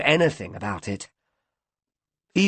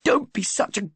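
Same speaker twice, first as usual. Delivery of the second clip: furious cry; 3 dB louder than usual. A narrator's reading. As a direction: spit the insult at him at the top of her voice. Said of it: "she cried".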